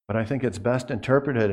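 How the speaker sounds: ordinary talk, nothing marked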